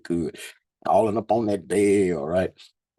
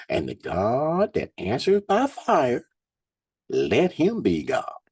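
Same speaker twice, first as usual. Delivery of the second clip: raised, slow preaching read; scripture reading; 4 semitones higher, slower than usual. Acted. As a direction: lift the verses up like a preacher. Reads scripture aloud.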